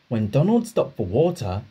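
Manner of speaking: usual speaking voice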